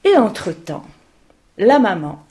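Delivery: ordinary delivery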